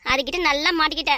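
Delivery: normal talking voice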